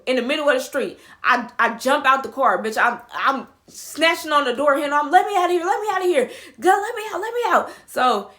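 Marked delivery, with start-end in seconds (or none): 5.1-7.7 high, loud, climbing pleas; frantic demand. Retold or imitated. Passenger yanking the door handle, frantically. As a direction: rattle it out in mock panic.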